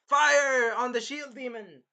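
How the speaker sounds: loud, high, drawn-out order; mock battle command. Villain ordering fire brigade to attack, mock battle command. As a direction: call out to the troops, hammed up.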